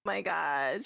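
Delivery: ordinary talk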